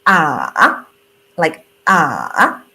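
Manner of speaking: low "Ah" dipping down then up; third tone dip. Shown as a demonstration. Teacher demonstrating the dipping third tone. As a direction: dip "Ah" low and bring it back up. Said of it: "So, like".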